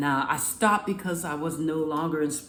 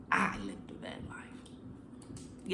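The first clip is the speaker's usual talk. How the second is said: slow breathy whisper, much softer; hushed confession; 8 semitones lower, 10 dB quieter, much slower than usual. Real, not acted. Vlogger confiding to viewers about van life, whispered slowly. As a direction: let it slip out as a slow secret.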